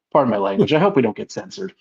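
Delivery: normal talking voice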